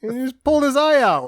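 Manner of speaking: high-pitched incredulous exclamation; mock astonishment. Co-host as a viewer seeing the eye patch, mock astonished. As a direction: jump high in pretend disbelief.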